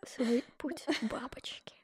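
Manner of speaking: slow hushed whisper. Pretend. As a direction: whisper it slowly, mock solemn. Mock solemn aside.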